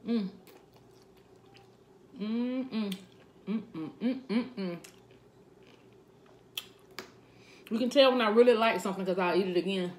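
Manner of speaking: humming while chewing; mouth full chewing. Real, not acted. Seen 0: Fork with greens to mouth at +0.1, then chews throughout.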